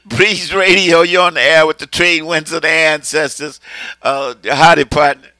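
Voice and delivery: loud, upbeat on-air greeting; radio announcer. Real, not acted. Radio host greets the next caller on air, announcer voice. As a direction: announce the station like a DJ picking up a call.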